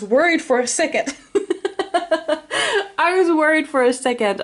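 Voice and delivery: laughing with relief, voice high; relieved laughter. Real, not acted. Relief after good card.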